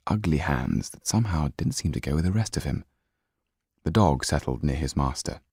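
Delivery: plain reading voice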